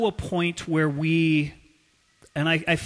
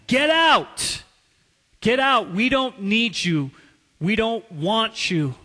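loud, high yell easing into firm rejection; retold angry scream; 5 semitones higher, 4 dB louder than usual. Preacher as wife throwing husband out, angry shout easing off. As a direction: open with a shouted outburst, then ease off slightly. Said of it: "screaming at him".

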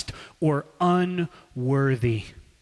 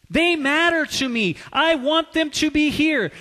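angry rebuke; 8 semitones higher, 5 dB louder than usual. Retold or imitated. Jesus scolding the disciples who block children, furious. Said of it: "He was furious at them".

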